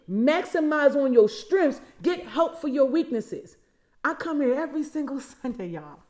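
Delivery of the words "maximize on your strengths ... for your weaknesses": preacher repeating the lesson for emphasis; slow, stressed repeat. Shown as a demonstration. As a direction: drive each word home slowly. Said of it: "I'm gonna say it again".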